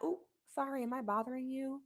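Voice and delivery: very soft, guilty little apology; sheepish bad kid. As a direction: act caught out, barely daring to speak. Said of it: "like a bad kid that got in trouble".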